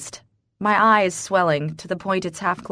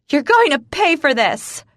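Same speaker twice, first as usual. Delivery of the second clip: high, loud threatening scream; 9 semitones higher, 6 dB louder than usual. Acted. Punched mistress screams a threat at the wife. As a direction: shriek the threat, nose bleeding. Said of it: "she screams".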